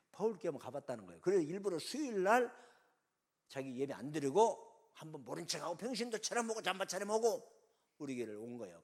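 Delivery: ordinary talk, nothing marked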